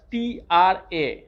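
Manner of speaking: ordinary talk, nothing marked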